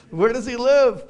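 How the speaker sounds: skeptical agent. Retold or imitated. Suspicious customs agent questions.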